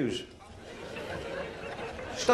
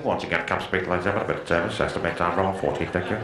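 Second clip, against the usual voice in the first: much lower, muffled mumble over a speaker; imitating a crackly cabin announcement; muffled tannoy announcement; 11 semitones lower than usual. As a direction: drone it like a bored pilot on the PA. Mumbled pilot announcement on plane.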